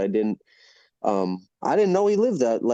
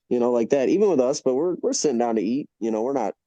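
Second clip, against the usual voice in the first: ordinary talk, nothing marked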